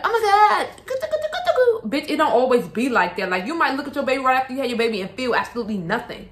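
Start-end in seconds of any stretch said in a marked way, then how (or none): 0.0-2.1 squealing high, laughing, fake-happy new mom; mock gushing new mom. Mocking internet moms, squealing fake-happy over the baby. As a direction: gush like a fake perfect influencer mom. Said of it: "oh, they be like".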